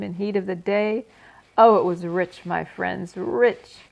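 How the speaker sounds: loud, gloating rat voice; gluttonous rat. Acted. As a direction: revel loudly like a stuffed rat.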